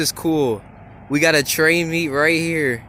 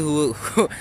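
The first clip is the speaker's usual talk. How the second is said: tripping over words, laughing; can't get the words out; stumbling over words; 3 semitones higher, 4 dB louder than usual. Real, not acted. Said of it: "I cannot speak today".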